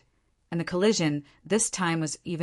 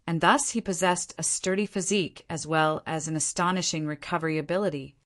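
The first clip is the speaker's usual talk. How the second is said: ordinary delivery, nothing marked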